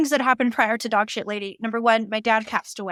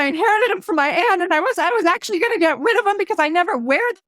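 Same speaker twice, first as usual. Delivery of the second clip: imitated tearful wail, high and rushed; hammed-up sobbing explanation; mock crying; 7 semitones higher, 3 dB louder than usual. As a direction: blubber in a high voice, retelling a weeping woman. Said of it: "the woman starts crying".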